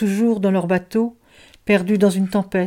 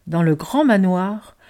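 usual speaking voice

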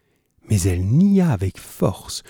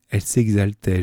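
usual speaking voice

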